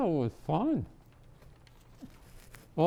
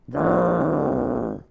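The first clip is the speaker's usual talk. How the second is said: snowblower growl; 2 semitones lower than usual. Acted. Snowblower noise in story.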